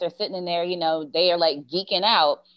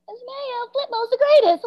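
very high-pitched kid imitation; student's voice. Little girl answers her teacher, very high kid voice. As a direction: pipe it up like an adoring pupil. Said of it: "this one little girl said".

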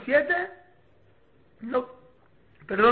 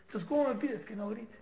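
normal talking voice

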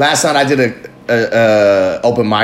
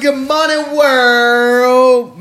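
high, quick announcer's good morning world; high DJ call to the world; high bright greeting; 9 semitones higher, much slower than usual. Pretend. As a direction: toss it out like a DJ hitting the mic. Morning show greeting repeated.

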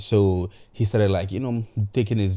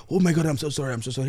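acted worry, pitched up; worried apology; 5 semitones higher, much faster than usual. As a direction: imitate a panicked friend apologizing. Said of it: "the other guy that was even worried".

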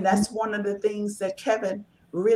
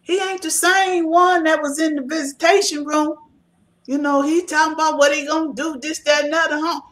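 lightly voiced relative, louder and higher; complaining family member; 5 semitones higher, 6 dB louder than usual. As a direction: imitate a relative's exasperated complaint. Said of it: "a lot of family members say that".

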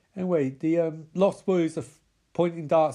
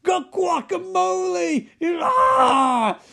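hammed-up horror building to a scream; high, drawn-out shouts of mock agony; Nicolas Cage; 10 semitones higher, 4 dB louder, much slower than usual. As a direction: scream like an overacting film victim. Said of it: "his best Nicolas Cage in a fucking Wicker Man".

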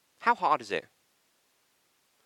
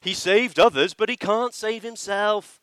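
slow, higher, louder sneering taunt; mocking jeer; 8 semitones higher, 7 dB louder, slower than usual. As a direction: jeer it like the chief priests at the cross. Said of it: "they mock him too".

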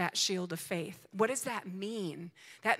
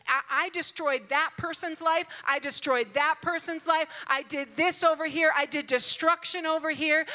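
raised, emphatic mock boasting; imitated taunt; 7 semitones higher, 6 dB louder than usual. Preacher voicing an enemy taunting the king, imitated taunting. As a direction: boast each deed loudly, as a retold taunt. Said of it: "And he's taunting him".

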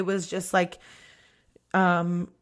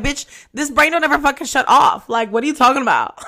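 louder, indignant comeback; sassy retort; 4 semitones higher, 6 dB louder than usual. Retold or imitated. Someone with ADHD answers advice to meditate, indignant. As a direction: push back hard, in mock indignation.

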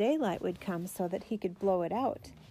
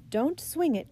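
higher, louder warning call; 7 semitones higher, 5 dB louder than usual. A narrator's reading. Mother warning her son about the lantern, calling out. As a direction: call out a sharp caution. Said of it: "called his mother".

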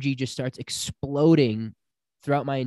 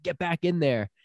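higher-pitched mock order; retold urgent command; 6 semitones higher than usual. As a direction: send him straight back in, imitating. Shaman orders him back, retold.

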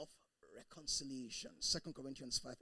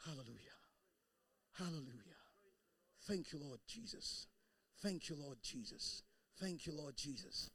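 soft worship; 3 semitones lower, 7 dB quieter than usual. Real, not acted. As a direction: murmur thanks to God tenderly. Quiet worship.

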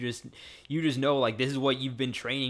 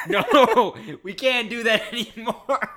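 higher, louder, laughing through the words; 11 semitones higher, 6 dB louder, slower than usual. Real, not acted. Host laughing as he overrides his cohost. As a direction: laugh as you say it.

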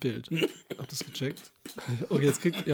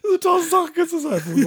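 high, louder amused words; laughing; 13 semitones higher, 8 dB louder than usual. Real, not acted. Scolding the spoiler while laughing. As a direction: still laughing through it.

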